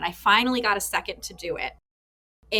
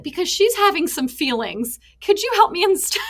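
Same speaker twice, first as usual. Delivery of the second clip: put-on high, louder kid voice, laughing through it; imitating her little son; 9 semitones higher, 6 dB louder than usual. Son asks dad for help instead of tired mom, laughing kid voice. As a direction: play a wise little boy with a laugh breaking in. Mother retells her son's comment.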